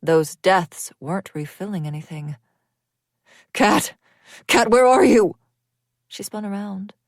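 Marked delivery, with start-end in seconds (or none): 3.5-5.4 loud, high frantic call; husband shouting for his wife; desperate calling. A narrator's reading. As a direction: search for her, calling out.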